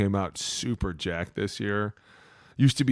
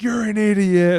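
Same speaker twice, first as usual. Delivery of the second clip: yelling at ref; 12 semitones higher, 8 dB louder than usual. Retold or imitated.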